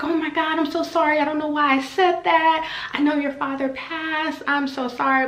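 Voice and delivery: high-pitched imitation of a mortified apology; mock a gushing, upset apologizer; flustered apology. Hygienist apologizing for mentioning the late father, flustered and high.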